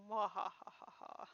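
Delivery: low, soft evil laugh; mustache twirling villain cackle. Retold or imitated. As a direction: cackle like a gloating sorceress. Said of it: "The sorceress is just kind of, uh, standing back going".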